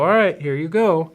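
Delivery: bright push like a parent; cheerful send-off. Retold or imitated. Parent figure sending a child soul back, high upbeat.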